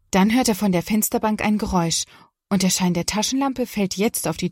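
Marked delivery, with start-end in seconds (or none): none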